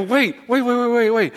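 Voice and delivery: high, rapid mock-frantic repeats; frantic waits, pitched high; panicked pleading. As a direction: beg him to stop, half-laughing in retold panic. Panicking underwater, grabbing guide.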